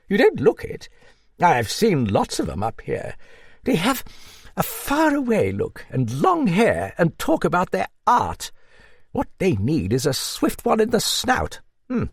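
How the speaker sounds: put-on high-pitched hearty old dame; old Duchess. Narrator voices the old Duchess.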